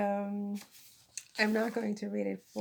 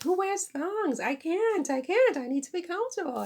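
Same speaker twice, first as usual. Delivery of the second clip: high, loud mock-indignant protest; exasperated comic rant, much higher and louder; comic exasperation; 9 semitones higher, 6 dB louder than usual. Real, not acted. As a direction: wail it in playful outrage, pitch swooping up. Comic rant about thongs.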